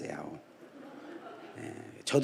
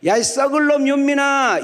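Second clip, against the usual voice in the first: high, loud country mother calling her son; mother's loud yell; 12 semitones higher, 5 dB louder than usual. Retold or imitated. Country mother calling her unresponsive son for the fourth time, loud yell. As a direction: bellow for the child with a scolding edge. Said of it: "목소리가 크고 네 번째 할 때는 이제 양념이 나가는".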